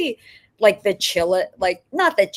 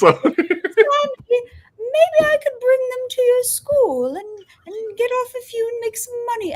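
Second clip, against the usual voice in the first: high, wailing British grandma voice, much higher; crying grandma impression; 5 dB louder than usual. Grandson's grandma offering to sell pills at school, in a high wailing voice. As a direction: whine it like a tearful old British grandma. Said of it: "Do her voice".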